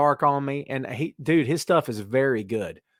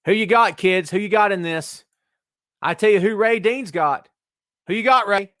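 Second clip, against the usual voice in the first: hype shout; 6 semitones higher, 4 dB louder than usual. Real, not acted. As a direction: fire the question at the crowd. Hyping the crowd about picks.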